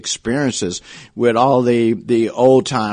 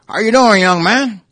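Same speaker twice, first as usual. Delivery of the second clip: put-on higher, louder Oklahoma drawl for an old coach; 11 semitones higher, 7 dB louder than usual. Old coach greeting a young man in an Oklahoma drawl. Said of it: "he said with this old Oklahoma drawl".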